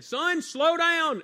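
high, loud and very slow call of Son, slow down; dad calling out. Retold or imitated. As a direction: shout it out slowly like a worried dad. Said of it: "my dad saying".

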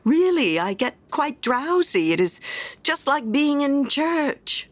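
a touch of a sleepy, contented wife; miller's wife. A narrator's reading. Miller's wife voiced in tale.

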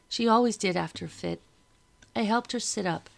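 usual speaking voice

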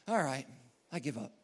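low, soft defeat; quiet, deflated surrender; resigned. Acted. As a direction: let it drop and give up. Acts out giving up.

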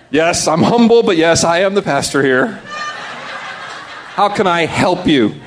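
self-important, hammed-up reply; grand false modesty; mock humility. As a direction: play the pastor pleased with himself. Mocks his own proud reply.

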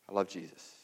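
very soft, low echo; hushed devotion. Retold or imitated. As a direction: let it fall to a quiet, intimate confession. Quoted testimony, repeated in a hush.

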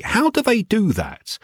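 put-on high, wide-eyed child's question; curious child. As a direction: ask it like a puzzled ten-year-old. Child asks brother about pop groups.

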